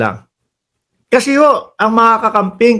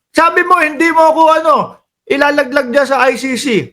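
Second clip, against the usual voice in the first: mock complaint; 6 semitones higher, faster than usual. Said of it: "baka sigawan ako".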